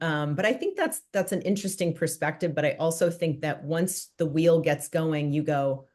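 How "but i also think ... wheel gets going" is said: ordinary talk, nothing marked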